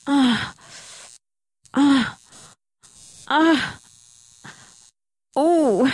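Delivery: loud high climaxing cries; orgasmic cries. Real, not acted. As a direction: build to a climax. Building to orgasm.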